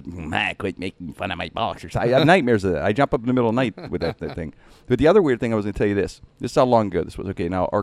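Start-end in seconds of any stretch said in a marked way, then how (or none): 0.0-2.0 softly threatening impression; Myron Cope. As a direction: quieter put-on voice, mock menace. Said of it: "Do not make fun of his".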